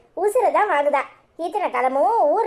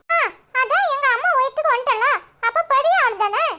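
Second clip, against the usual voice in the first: put-on much higher, chirpy small child; little boy voice; 9 semitones higher than usual. Cheeky boy greeting neighbour Lakshmi aunty, high child voice. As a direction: play a cheeky young kid.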